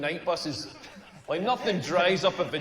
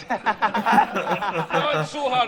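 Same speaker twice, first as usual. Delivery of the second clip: very slow, louder, cooing mock comfort; mock reassurance; 3 semitones higher, 4 dB louder, much slower than usual. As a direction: soothe him like a lover, hammed up. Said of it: "I wanna hear stuff like".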